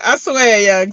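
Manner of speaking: ordinary delivery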